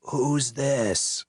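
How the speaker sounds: hoarse, annoyed question; irritated drunk croak; irritated rasp. A narrator's reading. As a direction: rasp it out, fed up. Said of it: "He sounded irritated".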